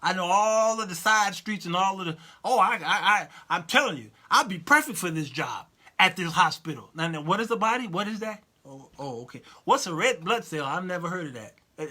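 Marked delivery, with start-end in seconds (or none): none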